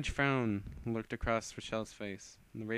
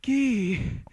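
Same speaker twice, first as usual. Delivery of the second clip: anguished cry; 13 semitones higher, 12 dB louder than usual. Acted. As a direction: scream his name, much higher and louder. Rochelle cries out for friend.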